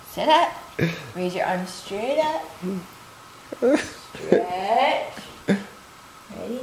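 soft, spaced-out stretch cues; hushed bedtime exercise guidance; yoga instruction. Real, not acted. Leads kids' bedtime yoga.

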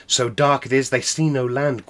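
ordinary delivery, nothing marked

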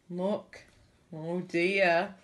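usual speaking voice

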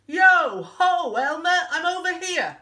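high, loud sing-out from afar; big playful shout; calling out. Acted. Hidden cousin calling out to Elmer.

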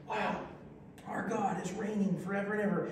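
ordinary talk

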